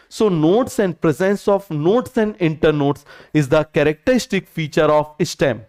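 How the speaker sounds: very slow, word by word dictation. Shown as a demonstration. Teacher dictating the key line for notes slowly. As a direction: read it out slowly so they can copy. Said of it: "बताओ जरा, हम क्या बोलेंगे?".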